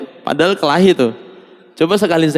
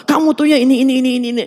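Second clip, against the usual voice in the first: scolding wife; 10 semitones higher, much faster than usual. Acted. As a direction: nag him relentlessly.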